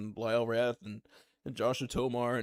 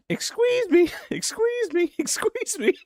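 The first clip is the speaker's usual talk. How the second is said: very high, squeaky, louder "excuse me"; brother's squeaky voice; 6 dB louder than usual. Retold or imitated. Imitating the brother voicing the Jar Jar toy, high squeak. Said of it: "my brother started saying".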